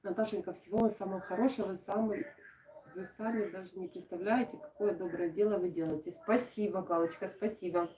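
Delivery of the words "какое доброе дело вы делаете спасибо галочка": ordinary talk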